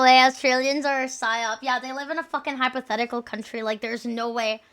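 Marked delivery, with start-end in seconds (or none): none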